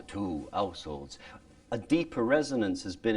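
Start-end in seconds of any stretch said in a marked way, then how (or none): 0.1-1.2 "two households" slower, lower and softer; dropped voice. Shown as a demonstration. Teacher showing how the voice drops. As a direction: drop the voice down deep. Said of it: "My voice has dropped even further too".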